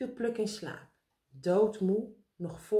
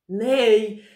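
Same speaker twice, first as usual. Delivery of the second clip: loud, stamping refusal; exasperated; 2 semitones higher, 11 dB louder than usual. A narrator's reading. Meneer Pen stamps his foot at Karel, exasperated. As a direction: burst out with one loud word. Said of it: "stampte met zijn voet op de grond".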